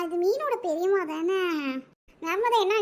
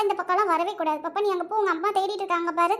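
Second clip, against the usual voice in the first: ordinary delivery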